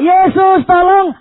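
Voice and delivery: high, loud stretched plea; retold desperate yell; crying out. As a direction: shout high, dragging out the name. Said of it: "dia teriak".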